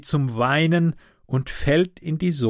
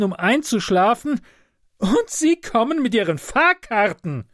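put-on high, plaintive complaining old man; a sleepless sufferer wailing his grievances; whining invalid; 8 semitones higher than usual. Sleepless sick passenger scolding the conductor, higher whining voice.

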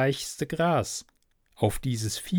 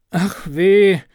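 higher, louder woeful cry; sighing lament; 6 semitones higher, 8 dB louder than usual. A narrator's reading. Little Klaus knocked over in the sack, lamenting loudly. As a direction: let out a pitiful wail. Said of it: "seufzte der kleine Klaus".

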